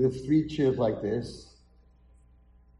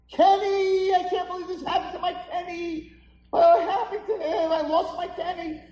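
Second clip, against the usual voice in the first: very high, wailing acted scream; screaming mother; 5 dB louder than usual. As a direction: wail like a mother who lost her child. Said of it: "she starts screaming".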